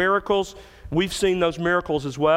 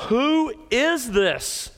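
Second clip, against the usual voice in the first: higher, slow, hushed question of awe; a hint of frightened disciples; awed fear; 8 semitones higher, much slower than usual. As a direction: ask it slowly, almost afraid of the answer. They became very much afraid.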